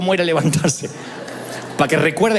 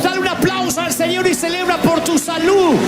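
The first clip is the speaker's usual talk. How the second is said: high, shouted revival preaching; preaching at full force; 10 semitones higher than usual. Acted. Pastor urging the congregation to celebrate, full-force shouting. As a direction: whip the church into celebration.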